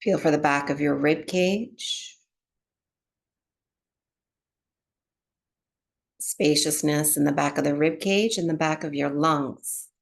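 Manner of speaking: calm, unhurried meditation voice with long pauses; guided relaxation. Real, not acted. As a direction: ease them gently into breath awareness, phrase by phrase. Guides breath awareness and body relaxation.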